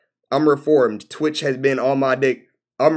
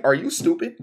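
usual speaking voice

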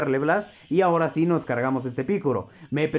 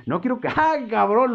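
high, delighted yelp; laughing outburst; 8 semitones higher than usual. Real, not acted. Streamer yelping with delight at the winning grab. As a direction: burst out laughing in triumph.

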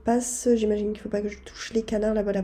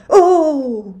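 sharp loud higher oh; surprised oh; 5 semitones higher, 12 dB louder than usual. Real, not acted. Gamer nearly falls off a platform, loud startled oh. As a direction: caught off guard, blurt it out. Sudden fright in tricky level.

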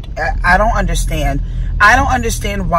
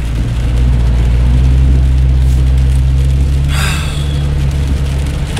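long deep sigh. Real, not acted. Frustrated about leaking car.

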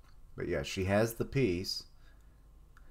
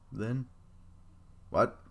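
ordinary talk

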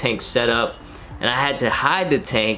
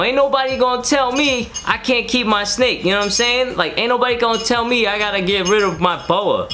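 1 defiant; 10 semitones higher than usual. Real, not acted. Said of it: "under my own roof".

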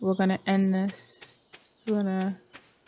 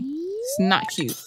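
exasperated whine; 13 semitones higher, slower than usual. Real, not acted. Player scolds characters playing around, high drawn-out whine. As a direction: voice climbing high on a stretched CUTE.

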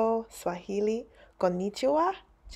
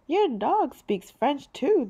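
smiling; 7 semitones higher, 3 dB louder, slower than usual. Acted.

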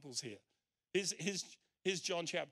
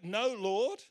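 incredulous; 5 semitones higher, 8 dB louder than usual. Retold or imitated. Quoting an absurd contradictory reply.

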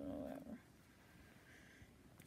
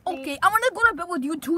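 silly eager voice; 4 semitones higher, 6 dB louder, faster than usual. Acted. Odd put-on voice.

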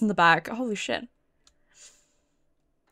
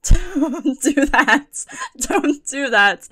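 drawn-out words broken by nervous laughter; shaken laughing protest; much slower than usual. Real, not acted. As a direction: protest slowly while laughing off a scare. Laughing protest after jump scare.

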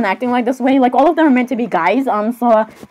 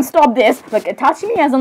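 ordinary talk, nothing marked

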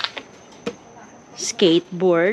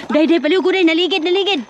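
excited alarm; 9 semitones higher, 4 dB louder, much faster than usual. Real, not acted. Coffee rolling away, alarm.

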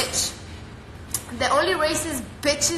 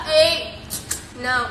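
usual speaking voice

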